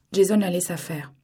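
plain reading voice

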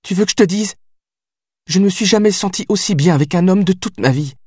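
high, heated and unrelenting; high heated outburst, listing what he means to her; outraged. Acted. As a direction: pour it all out at him, wounded. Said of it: "outrée par mes reproches".

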